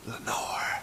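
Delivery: breathy, voiceless whisper of the name. A narrator's reading. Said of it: "the whispered word".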